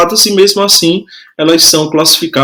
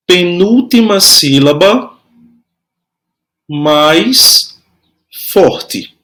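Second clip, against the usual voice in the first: long pauses between dictated pieces; measured teacher's dictation for note-takers; slow dictation; much slower than usual. Shown as a demonstration. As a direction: hold "mais" up, then let "forte" fall.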